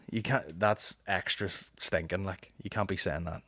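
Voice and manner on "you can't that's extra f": ordinary talk, nothing marked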